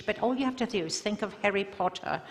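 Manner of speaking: Harry Potter style British English; British accent. Shown as a demonstration. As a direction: put on a British accent, brisk and light. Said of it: "of course he's British".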